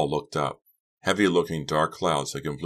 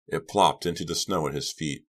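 ordinary delivery

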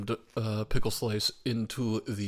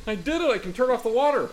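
high, loud victory shout; triumphant; 12 semitones higher, 8 dB louder, faster than usual. Real, not acted. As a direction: celebrate like you won. Jar finally opens, triumph.

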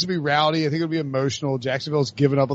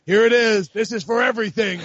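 imitating a big announcer call; loud, high, hammed-up game call; mock dramatic broadcast climax; Jim Nance; 7 semitones higher, 4 dB louder than usual. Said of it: "give us your best call".

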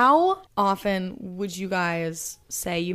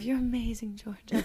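whispered; 9 dB quieter than usual. Retold or imitated. Retold echo in bed.

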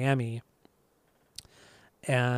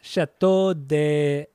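over-the-top French accent, drawn out slowly; 3 dB louder, much slower than usual. Shown as a demonstration. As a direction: attempt an exaggerated, slow French accent. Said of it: "an over-the-top French accent".

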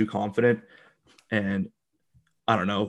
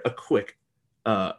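ordinary talk, nothing marked

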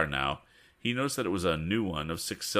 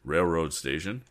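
plain reading voice

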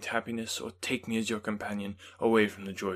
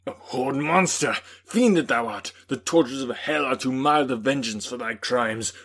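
a loud, higher furious outburst; raging; 4 semitones higher, 9 dB louder than usual. A narrator's reading. Victor cursing the creature before springing at him, raging loud. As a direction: hurl curses at the fiend. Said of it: "My rage was without bounds".

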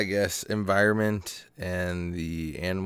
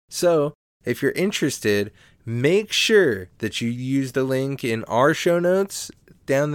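upbeat sponsor read, a bit louder and higher; 3 semitones higher, 4 dB louder than usual. Acted. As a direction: sell it like a podcast ad spot.

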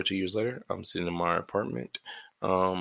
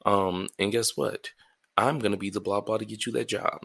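ordinary delivery

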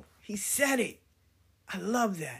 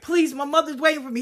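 loud, higher, fast pleading; pleading child; 6 semitones higher, 5 dB louder, faster than usual. Retold or imitated. Her twelve-year-old self begging the attacker, loud and pleading. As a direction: beg like a scared kid. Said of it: "I was crying".

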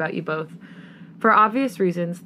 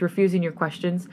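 ordinary delivery, nothing marked

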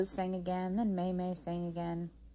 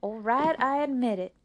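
exasperated concession; 5 semitones higher, 7 dB louder than usual. Acted. Rose gives in, exasperated.

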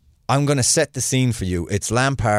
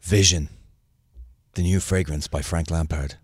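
sultry perfume ad; 7 semitones lower than usual. Pretend. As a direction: purr it like a cologne commercial. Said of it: "I expected this voice".

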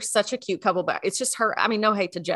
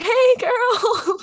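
high-pitched, put-on "hey" to someone intimidating; gushy awkward greeting; 13 semitones higher, 4 dB louder than usual. Greeting the intimidatingly cool girl, gushy and high-pitched. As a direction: squeal it out unnaturally high, overeager. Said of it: "I don't act like that".

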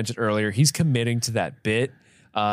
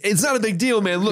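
high-pitched exasperated impression; Dave Chappelle; 8 semitones higher than usual. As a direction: wave it off in a squeakier comic voice. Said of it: "Dave Chappelle's like".

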